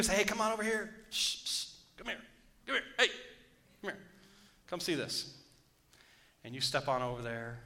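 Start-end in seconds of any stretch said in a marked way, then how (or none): 0.2-5.3 enticing, hushed beckoning with shh; tempting whisper. Retold or imitated. The enemy luring a believer over, coaxing hushed call. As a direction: lure them over in a coaxing hush. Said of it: "The enemy's gonna whisper you to you".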